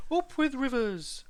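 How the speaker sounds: high, drawn-out dinosaur hoot; sing-out duckbill cheer. A narrator's reading. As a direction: hoot it out slowly, well above your own pitch. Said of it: "they hooted".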